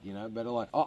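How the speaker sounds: ordinary talk, nothing marked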